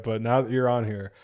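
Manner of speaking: normal talking voice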